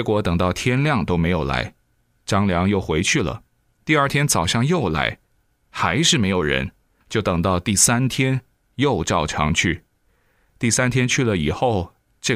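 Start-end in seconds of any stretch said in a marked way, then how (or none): none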